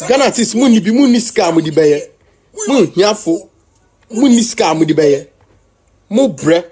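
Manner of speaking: mocking shout in Twi; loud taunt. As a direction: jeer at them proudly and loud. Loud taunting of other artists.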